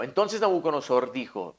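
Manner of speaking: ordinary talk, nothing marked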